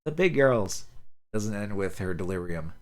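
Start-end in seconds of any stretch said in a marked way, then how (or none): 0.0-0.9 put-on high, childlike plea to be let in; a small girl begging to join the big girls; dying girl lost in a childhood memory, high and pleading; delirious little girl.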